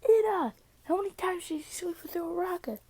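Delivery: high voice. Retold or imitated. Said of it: "he's like".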